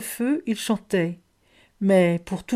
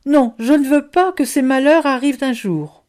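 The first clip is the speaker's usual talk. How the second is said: raised, louder protest; crying out; 6 semitones higher, 6 dB louder than usual. A narrator's reading. Boustru protests the future he has seen, crying out. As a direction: refuse it with a loud cry. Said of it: "s'écria Boustru".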